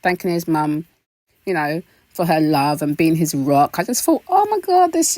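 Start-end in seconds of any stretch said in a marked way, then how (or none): 3.8-5.2 gushing. Real, not acted. Overcome by son's cute speech.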